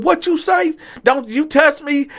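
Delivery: high-pitched acted confrontation; angry challenge. Customer getting up to confront the waiter, angry challenge. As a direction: challenge him with voice leaping up.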